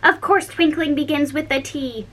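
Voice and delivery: sharp, impatient king. A narrator's reading. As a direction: snap it back at him. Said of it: "said the King sharply".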